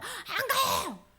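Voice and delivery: high, slow, stubborn wail; elder son refusing to come in, pouting; sulky refusal. Acted. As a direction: drag it out stubbornly.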